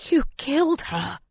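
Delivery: high, anguished accusation; crying out. A narrator's reading. Grieving husband accusing his lover, crying out. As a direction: cry it out in grief, pitched well up. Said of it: "he cries".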